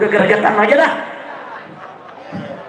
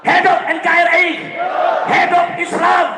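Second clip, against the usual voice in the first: high-pitched, driving political oratory; rousing speech building to shouted slogans; fiery rally preaching; 6 semitones higher than usual. Real, not acted. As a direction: whip the crowd into chanted answers. Preacher rallies the crowd.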